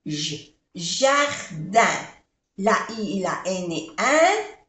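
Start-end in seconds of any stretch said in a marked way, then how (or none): 0.0-0.5 j as a soft buzzing zh; French j sound. Shown as a demonstration. Teacher isolating the French j for students. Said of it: "se pronuncia j". 4.0-4.6 un as a French nasal vowel. Shown as a demonstration. Teacher showing the nasal vowel of jardin. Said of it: "que es una vocal nasal".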